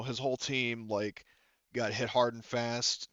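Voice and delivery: ordinary talk, nothing marked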